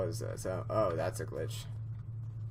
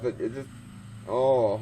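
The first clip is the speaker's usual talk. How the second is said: normal talking voice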